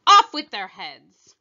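loud, commanding queen; queen's order. A narrator's reading. Queen orders the gardeners beheaded, loudly. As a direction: bellow the sentence at them.

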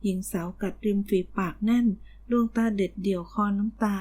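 ordinary delivery, nothing marked

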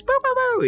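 high-pitched popping gun noises; imitated gunshots. Host showing how common gunshots were, playful imitation. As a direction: toss off a quick mock gunfire noise.

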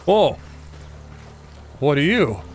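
sudden jolt of surprise; startled. Real, not acted. Gamer startled by a creature in the jungle. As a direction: caught off guard by something moving.

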